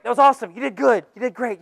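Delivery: ordinary delivery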